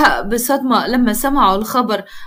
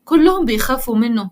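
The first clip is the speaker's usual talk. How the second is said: ordinary delivery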